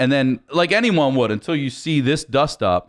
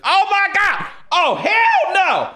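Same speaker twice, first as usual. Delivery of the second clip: bus driver outrage. Retold or imitated. Bus driver yelling at the attackers, high mock outrage. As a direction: shriek it like the driver losing it. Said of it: "she's like".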